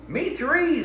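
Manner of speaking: high mouse chirp; a tiny mouse eagerly agreeing; little mouse voice. Acted. Mouse joins in.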